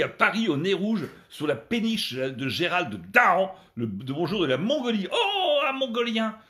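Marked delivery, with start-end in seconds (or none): 5.1-6.4 high, slow, drawn-out exclamation with the word Mongolien; a slip said in an amused, stretched voice; surprised wrong word. Real, not acted.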